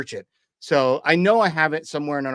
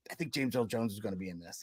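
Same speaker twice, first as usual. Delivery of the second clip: low, very soft murmur; retold quiet remark, kept down for the theater; hushed aside; 6 semitones lower, 14 dB quieter, faster than usual. Friend to friend in the movie theater, hushed aside.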